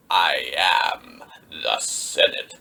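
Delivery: very low, very slow villain shout; roaring Palpatine. Retold or imitated. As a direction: drag each word out like a tyrant. Palpatine's famous defiant line.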